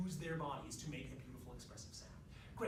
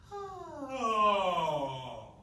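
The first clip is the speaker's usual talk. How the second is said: loud, sliding sighed "oh"; long sigh falling from a high start; wailing vocal slide downward; 4 semitones higher, 13 dB louder than usual. Shown as a demonstration. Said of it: "they just can't use their chest voice".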